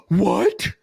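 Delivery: exaggerated gasp and high-pitched disbelief; hammed-up astonished gasp; mock shock. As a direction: imitate a customer utterly blown away. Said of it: "they are blown away".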